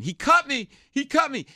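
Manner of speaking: loud, high-pitched yell of alarm; high, outraged retold scream. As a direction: scream it like a wounded bar patron. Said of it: "he starts screaming".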